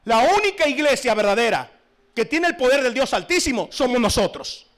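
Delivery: loud, high fiery sermon; preaching at full force. Real, not acted. Preacher scolding the congregation, loud fiery preaching. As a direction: thunder it from the pulpit. Preacher rails against other religions.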